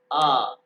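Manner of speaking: a long open आ, loud and clear; letter sound. Shown as a demonstration. Teacher calls out the first letter of the alphabet loudly. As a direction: sing out the letter आ. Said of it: "सबसे पहले है".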